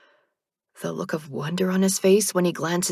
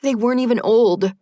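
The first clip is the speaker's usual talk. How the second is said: higher, louder anguished protest; 5 semitones higher, 7 dB louder than usual. A narrator's reading. Narrator protests in anguish that they were young. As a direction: plead in grief. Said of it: "I cry out in anguish".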